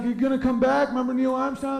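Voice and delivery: mocking high voice; goofy high voice. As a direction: pitch it up like a cartoon. Voicing moon conversation.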